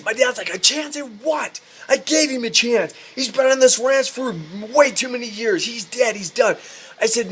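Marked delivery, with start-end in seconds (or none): 0.5-6.6 high-pitched, exasperated mock outburst; retold indignant rant; indignant dad. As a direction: imitate a fed-up father refusing. Acted dad furiously refusing.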